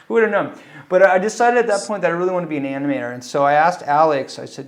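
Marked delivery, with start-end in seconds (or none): none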